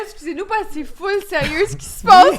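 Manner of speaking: laughing, can't stay serious; giggling through the words; trying not to laugh. Real, not acted. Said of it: "Je peux pas garder mon sérieux".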